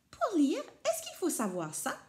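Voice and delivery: high, curious little voice; a small boy asking a question; curious child. A narrator's reading. Rikili asking papa about the letters, high and curious. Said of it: "Il demande".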